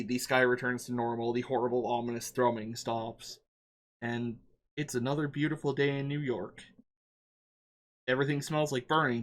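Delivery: ordinary delivery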